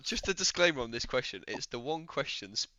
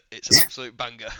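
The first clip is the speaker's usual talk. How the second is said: laughing; 14 dB louder than usual. Real, not acted. Laughing hype about song.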